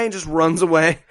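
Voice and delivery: ordinary talk, nothing marked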